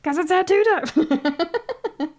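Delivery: high, loud, drawn-out announcement; excited announcement. Real, not acted. Streamer announces tattoo time to chat, excited. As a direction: sing out the big news, slow and high.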